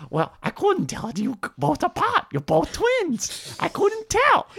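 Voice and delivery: very high, whiny-goofy comic voice; hammed-up, high-pitched comedian impression; Adam Sandler. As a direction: plead cluelessness in a squeaky put-on voice. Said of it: "Adam Sandler, like, comes up, he's like".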